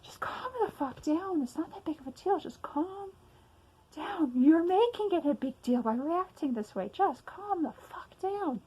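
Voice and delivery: a pressed, strained command to yourself; gritted self-scolding. Retold or imitated. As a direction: grit your teeth and order yourself to calm down. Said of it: "no matter how I tell myself".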